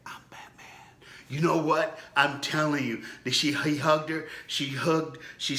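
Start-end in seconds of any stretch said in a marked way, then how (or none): 0.1-0.7 breathy secret whisper. Retold or imitated. Little boy telling his crying mom who he is, whispered.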